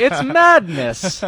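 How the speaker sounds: slow, high, overdramatic declaration; infomercial guy. Retold or imitated. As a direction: drag it out like an ad. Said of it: "turn into the infomercial guy".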